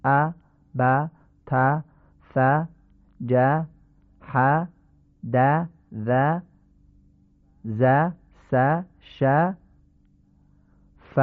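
each letter alone with a thin, front short 'a'; light Persian-like fatha. Shown as a demonstration. Teacher showing the twenty plain letters, thin fatha.

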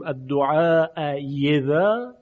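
الدعاء یذا with a clear full y starting یذا; long الدعاء, then a plainly consonantal y on یذا; ibdal to ya. Shown as a demonstration. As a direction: replace the second hamza with a distinct y in یذا. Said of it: "مثلاً اگر اینجا گفتیم".

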